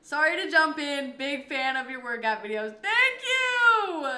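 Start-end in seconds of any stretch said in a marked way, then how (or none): 2.8-4.0 loud, high, gushing thank you; a loud, high, overjoyed thank you; excited thanks. Real, not acted. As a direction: burst out with thrilled thanks. Thanking a fan for the compliment.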